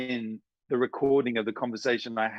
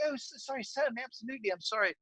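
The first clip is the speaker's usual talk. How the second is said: apologetic BBC caller; 10 semitones higher, faster than usual. Retold or imitated. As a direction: apologise profusely in a high phone voice. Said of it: "They're like".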